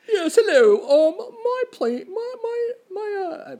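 high, stammering put-on lawyer voice; mock lawyer voice. Man playing his own lawyer in court, high stammering voice. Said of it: "Did he do a different voice for his lawyer".

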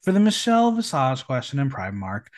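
ordinary talk, nothing marked

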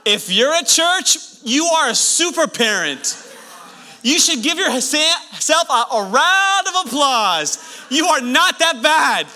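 loud, high, slow rallying shout; booming, drawn-out encouragement; full-force preaching. Real, not acted. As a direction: preach it out to the whole crowd, stretching each phrase.

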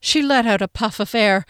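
usual speaking voice

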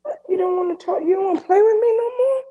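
put-on high, whimpering child voice near tears; a hurt child begging a friend to play; crying little kid. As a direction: whine it like a little kid about to cry. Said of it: "I felt like a little kid".

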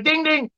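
high, soft chime imitation; ping it like a tiny bell; imitated ding. Man mimics the fridge dinging again, high. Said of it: "I'm like".

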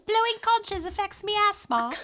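very high, loud mock-Cockney boy; Piggy, Cockney accent. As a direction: push an exaggerated Cockney way up high. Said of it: "I don't know why he's Cockney now".

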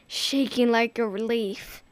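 ordinary delivery

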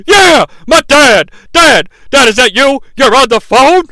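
high, loud, thrilled outburst; excited shout. Retold or imitated. Shane thrilled his dad is on the phone, high loud shout. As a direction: cry out gleefully to dad.

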